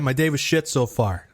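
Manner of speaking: ordinary talk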